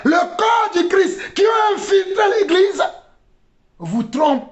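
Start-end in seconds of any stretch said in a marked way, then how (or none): 0.0-2.8 high-pitched preaching shout; fiery sermon delivery; impassioned preaching. Real, not acted. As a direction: cry it out to the congregation. Preaches at full force.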